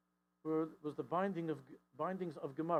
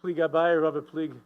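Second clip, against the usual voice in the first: 1 Talmud recitation; 2 semitones higher, 13 dB louder than usual. Retold or imitated. Wife recalls her father's study, loud singsong. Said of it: "she started to say".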